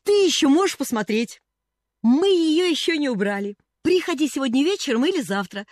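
put-on high, loud eager girl; can hardly contain her excitement; girl eagerly inviting the cashier to see the tree; excited invitation. A narrator's reading.

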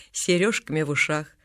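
ordinary delivery

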